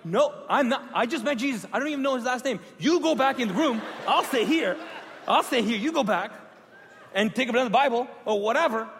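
loud, high, panicked protest; frantic refusal. Retold or imitated. Refusing the pastor in a heated argument, loud panicked protest. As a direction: argue back, scared and loud.

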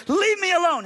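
high, exasperated yell; blowing a gasket. Retold or imitated. Cornered bus passenger to his relentless evangelist, exploding. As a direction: explode at a man who won't stop. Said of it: "finally just blows a gasket".

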